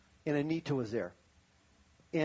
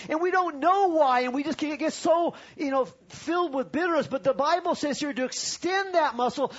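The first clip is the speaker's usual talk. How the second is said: much higher, much louder impassioned preaching; full-force preaching; 9 semitones higher, 6 dB louder than usual. Acted. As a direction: drive it home to the congregation at full voice.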